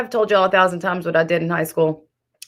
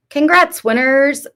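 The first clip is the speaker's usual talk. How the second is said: sarcastic; 9 semitones higher, 3 dB louder, slower than usual. Real, not acted. Mock congratulations to haters.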